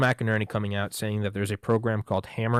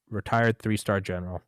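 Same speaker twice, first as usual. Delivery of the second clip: normal talking voice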